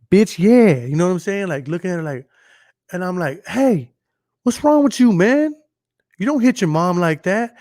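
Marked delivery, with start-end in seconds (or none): 0.1-0.8 loud, raised-pitch imitation of a defiant kid; mock defiance. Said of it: "he wasn't even scared about doing it". 3.4-7.5 high, raised-voice telling-off; retold scolding. Retold scolding of a stranger's kid.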